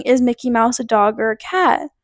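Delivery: usual speaking voice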